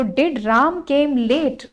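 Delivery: very slow, word by word; slow dictation. Shown as a demonstration. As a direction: spell it out for students to write. Teacher repeating the sentence.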